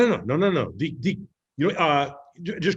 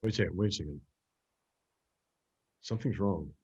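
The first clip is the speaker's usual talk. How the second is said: low worried hush; 8 semitones lower, 9 dB quieter, faster than usual. Acted. Doug notices something off.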